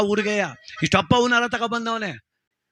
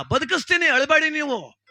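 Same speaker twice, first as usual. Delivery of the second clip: much higher, confident promise; a high, cocky reassurance; Dasappa's voice; 9 semitones higher than usual. Retold or imitated. Dasappa promises the weeping wives to revive him, much higher voice.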